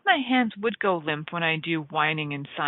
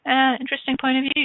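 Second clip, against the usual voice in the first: "Uh, interesting point of view" lifted high and bright, a rising "Uh"; lilting interesting point of view; 7 semitones higher, 3 dB louder, faster than usual. Shown as a demonstration. Facilitator to listeners, a bright lifted "interesting point of view". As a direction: sing out "interesting" with a playful lift.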